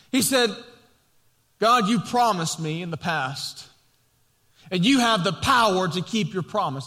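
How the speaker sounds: full-force pulpit preaching; impassioned preaching. Acted. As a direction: preach it out to the congregation.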